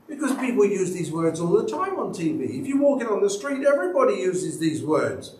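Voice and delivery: usual speaking voice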